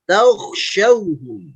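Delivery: slow "taukhshauhum" with dark au before kh; thick kho. Shown as a demonstration. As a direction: draw out "taukhshauhum", first syllable heavy. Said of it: "Perhatikan mulutnya". ("taukhshauhum" pelan dengan "taukh" tebal)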